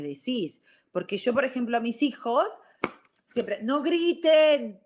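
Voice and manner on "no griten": drawn-out, higher-pitched mom shout; acting out yelling at the kids; retold parental yell. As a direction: holler it slowly like a fed-up mom. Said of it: "están gritando".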